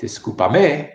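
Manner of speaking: discúlpame stressed on the last syllable, disculpa-MÉ; discúlpame drawn out, mis-stressed at the end; wrong stress demo. Shown as a demonstration. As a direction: slowly push the final "me" of discúlpame up. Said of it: "not discúlpame".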